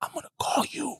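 hushed whisper, barely voiced. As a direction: breathe it out secretly so the cops won't hear. Friend whispers walking past.

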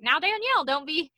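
hammed-up Tyra playing a Cajun accent; mock Tyra accent. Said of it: "whatever the fuck accent that she's doing".

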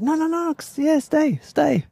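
put-on very high, light voice of a young monk. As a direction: squeak it out like an eager young monk.

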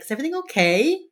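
high, loud worried question; worried wife. Retold or imitated. Wife checks on husband, high worried question. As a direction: ask like an anxious wife. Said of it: "then she's like".

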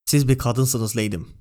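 plain reading voice

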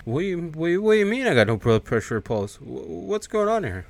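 acted baffled questioning, voice rising; confused disbelief. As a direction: stumble over it in mock disbelief. Acts Freddy told of no pulse.

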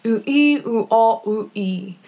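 "oo, ee, oo, ah, oo, ee" as pure vowels without consonants; vowels only. Shown as a demonstration. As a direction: sound out only the vowels "oo, ee, oo, ah, oo, ee", each drawn out. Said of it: "without saying any of the consonants".

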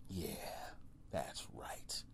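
whispered smug yeah that's right. Real, not acted. Comic whispers smugly to the audience after a jab. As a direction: breathe it out, pleased with himself.

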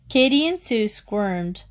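plain reading voice

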